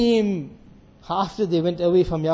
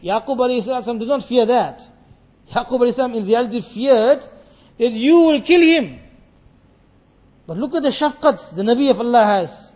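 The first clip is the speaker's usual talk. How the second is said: ordinary talk, nothing marked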